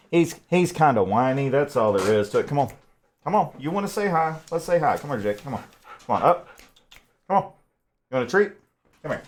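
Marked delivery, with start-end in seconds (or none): 2.5-6.5 coaxing the dog. Real, not acted.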